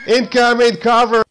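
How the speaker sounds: loud, high battlefield shout; urgent incoming-fire alarm; shouted warning. Pretend. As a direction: yell for the squad to take cover.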